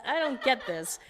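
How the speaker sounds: high, fast, laughing bewilderment; laughing disbelief. Retold or imitated. Singer baffled at being asked to breathe, laughing high and fast. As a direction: blurt it out in giggling confusion. Retelling confusion at breather request.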